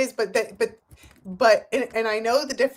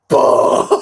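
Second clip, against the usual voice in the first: imitated slam; 11 dB louder than usual. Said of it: "it was like".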